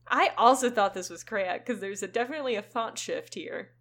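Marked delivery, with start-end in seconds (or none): none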